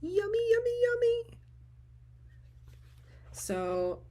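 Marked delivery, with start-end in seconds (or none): 0.0-1.4 much higher, sing-song cooing; swooning over fabric. Retold or imitated. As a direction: coo like the fabric is candy. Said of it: "make you go".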